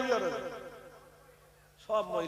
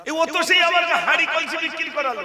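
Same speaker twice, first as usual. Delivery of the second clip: raised, higher indignant voice; mock telling-off of a child, pitched up; louder acted protest; scolding mother; 6 semitones higher, 6 dB louder than usual. Acted mother correcting her son.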